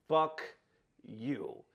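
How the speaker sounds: slow, with a pause between words; deadpan dismissal. Retold or imitated. Victim realizing it's a scammer, deadpan dismissal. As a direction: drop the second word low and quiet. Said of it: "so now I'm like".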